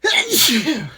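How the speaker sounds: loud real sneeze; big explosive sneeze; sneezing. Real, not acted. Sneezing fit continues.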